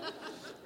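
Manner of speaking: a short breathy chuckle; soft laugh. Real, not acted. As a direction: let a small laugh escape. Therapist laughs at the couple.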